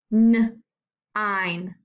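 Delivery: "nine" split after a loud N; nine sounded out. Shown as a demonstration. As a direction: punch the N, pause, then finish "nine". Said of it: "Let's listen to the I in these words".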